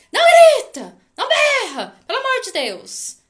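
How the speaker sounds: put-on a shrill, very loud high shout, the kid copying the parent; hammed-up yell, the child parroting the scold; mock child yelling back. Nervous child echoing the parent's scolding, mock shout.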